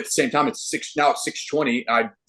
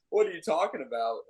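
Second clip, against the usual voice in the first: high, softer incredulous question; mock baffled, pitched up and quieter; retold disbelief; 5 semitones higher, 6 dB quieter than usual. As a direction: ask in amused disbelief, voice lifted. Retold disbelieving comeback, after laughing.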